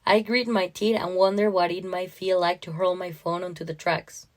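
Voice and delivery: ordinary delivery, nothing marked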